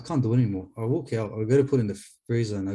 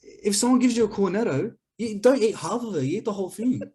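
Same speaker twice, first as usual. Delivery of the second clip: high-pitched indignant rant about ice cream; heated defense of eating the whole tub; 10 semitones higher than usual. Real, not acted. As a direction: argue the point, voice way up.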